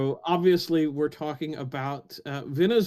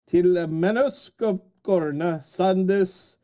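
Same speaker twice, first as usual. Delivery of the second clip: put-on slow, solemn recital of Old Norse verse, higher, louder, Finnish-sounding; Old Norse verse in a Finnish-sounding accent; 4 semitones higher, 5 dB louder than usual. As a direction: declaim the ancient lines slowly, like a Finn attempting Old Norse. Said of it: "you sounded mostly Finnish".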